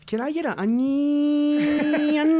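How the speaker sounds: high, slow, stretched vowels; Valley Girl voice. Retold or imitated. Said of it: "vowels get stretched".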